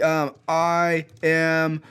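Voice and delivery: normal talking voice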